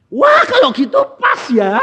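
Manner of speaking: high, loud joyful exclamation; delighted shout. Retold or imitated. To the crying debtor, a high, loud delighted shout. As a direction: burst out in delight. Delighted, the gift fits exactly.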